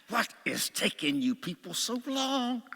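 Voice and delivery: high-pitched, drawn-out exasperation; mock complaint, stretching the words upward; impatient. Driver waiting for the family in the car, impatient.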